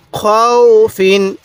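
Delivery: খৌ held as a long glide, then ফিন; drawn-out recitation. Shown as a demonstration. Teacher showing how to read it, খৌ drawn out. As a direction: stretch খৌ slowly before ফিন. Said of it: "কীভাবে পড়তে হবে?".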